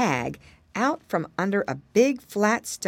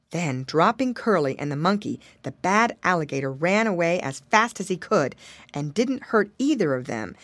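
ordinary delivery

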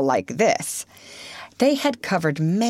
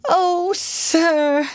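high put-on washerwoman voice, weepy; crying afresh; 11 semitones higher than usual. A narrator's reading. Toad disguised as washerwoman pleading with driver, high weepy voice. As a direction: wail it in a much higher disguise voice. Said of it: "crying afresh".

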